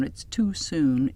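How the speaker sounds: plain reading voice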